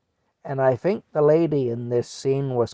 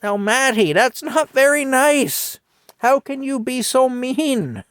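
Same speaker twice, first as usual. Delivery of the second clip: put-on wag your finger like a three-year-old; scolding little kid; 10 semitones higher, 5 dB louder than usual. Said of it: "I said".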